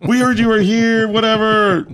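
high, slow gushing recognition; high, gushing staff excitement; delighted recognition. Retold or imitated. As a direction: gush like a fan who just met a host. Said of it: "And they go".

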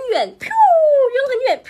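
mimicked throw noise. A narrator's reading. Mimicking apple throw sound.

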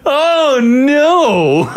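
high, laughing oh no; laughing groan. Real, not acted. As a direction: laugh in horrified disbelief. Reacting to gross dinner story.

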